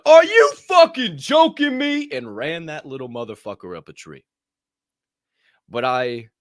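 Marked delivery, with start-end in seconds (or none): 0.0-2.1 furious yell, high and slow; old self's rage. Retold or imitated. Old self yelling at the kid who bumped him. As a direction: roar it like his hotheaded old self. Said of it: "The old Colt would have slammed".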